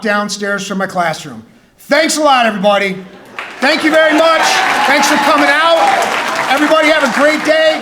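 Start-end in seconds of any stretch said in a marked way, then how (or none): 1.8-7.8 loud, high, hammed-up goodbye to the crowd; mock big sign-off. Teacher fake-ending his talk to the students, loud mock farewell. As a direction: pretend the talk is over and wrap it up big.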